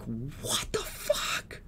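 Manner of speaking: mom's slow, breathless whispered gasp; shocked whisper. Retold or imitated. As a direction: whisper it slowly, stunned like mom. Mom hearing of robbery.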